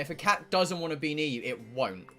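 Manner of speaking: ordinary delivery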